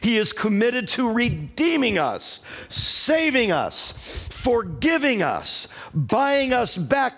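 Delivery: high-pitched, drawn-out preaching phrases; emphatic preaching. Real, not acted. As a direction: preach it slowly, stretching each promise.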